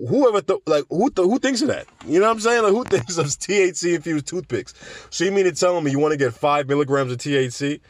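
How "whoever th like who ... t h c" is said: pitched way up in disbelief; who even thinks of this, amazed; baffled high-pitched disbelief; incredulous. Real, not acted. Incredulous at the toothpick idea.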